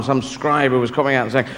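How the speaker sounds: usual speaking voice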